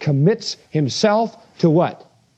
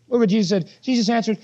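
ordinary delivery

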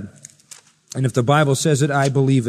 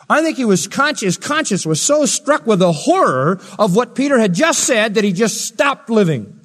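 loud, raised, emphatic pulpit delivery; forceful preaching; 7 semitones higher, 6 dB louder than usual. Real, not acted. Preacher telling how Ananias fell dead, forceful preaching. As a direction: punch the key words with a raised voice.

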